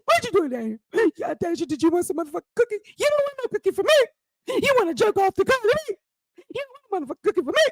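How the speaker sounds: fast, high-pitched sneering taunt; mocking taunt. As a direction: rattle it off high and quick to tease.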